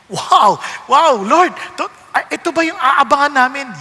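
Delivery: loud, delighted exclamation; loud joyful wow to the Lord; excited wow. Pretend. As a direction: burst out in amazed joy. Reacting to God's justice.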